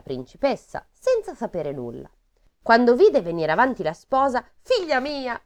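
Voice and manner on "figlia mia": exclaiming. A narrator's reading. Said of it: "esclamò".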